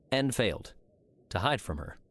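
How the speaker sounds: plain reading voice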